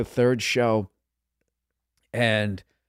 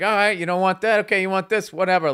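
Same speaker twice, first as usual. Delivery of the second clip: dismissive give-up; 8 semitones higher, 5 dB louder than usual. Retold or imitated. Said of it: "I was just like".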